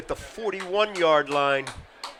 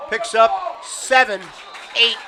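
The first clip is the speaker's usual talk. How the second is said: excited call; 5 semitones higher, 5 dB louder than usual. Real, not acted. Live play-by-play, a gain.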